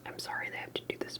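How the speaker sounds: ordinary delivery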